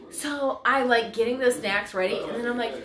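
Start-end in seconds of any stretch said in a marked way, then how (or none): none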